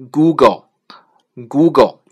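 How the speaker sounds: slow, clear Google said twice; careful English name. Shown as a demonstration. Teacher modelling Google slowly for learners. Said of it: "谷歌呢叫Google".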